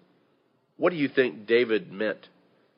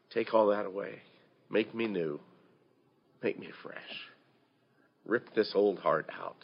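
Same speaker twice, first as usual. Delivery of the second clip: hushed prayer; 2 semitones lower, 10 dB quieter than usual. Retold or imitated. Preacher voicing David's hushed plea to God. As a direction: murmur it like a man on his knees.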